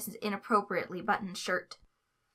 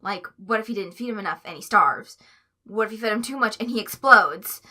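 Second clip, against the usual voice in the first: louder and louder frantic worry; escalating panic; 8 dB louder than usual. Acted. Tweek fearing he'll overfeed Stripe, louder frantic worry. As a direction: build the panic to a loud peak.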